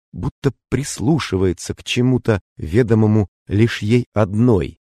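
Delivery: plain reading voice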